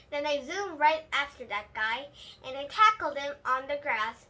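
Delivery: usual speaking voice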